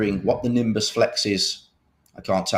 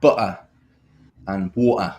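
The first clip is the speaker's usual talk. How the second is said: British accent; 3 semitones lower than usual. Shown as a demonstration. Demoing his accent to the co-host, a plain British accent. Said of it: "words people get me to say in my British accent".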